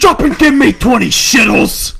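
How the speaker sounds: loud barking drill sergeant; barking gym coach. Retold or imitated. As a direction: bellow the order at a recruit.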